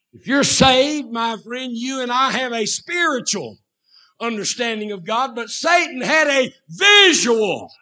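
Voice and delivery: high, loud pulpit proclamation; full-force preaching. Real, not acted. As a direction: preach it out, punching the key words high and loud.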